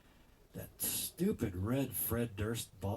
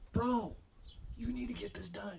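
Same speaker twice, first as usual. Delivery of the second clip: exasperated doctor; 12 semitones higher than usual. Retold or imitated. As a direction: play the fed-up doctor scolding. Doctor scolding him in story.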